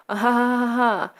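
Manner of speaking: deliberate, spelt-out "ah ha ha ha"; mock villain laugh. Russian man revealing his betrayal, mock villain laugh. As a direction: fake a gloating villain's laugh.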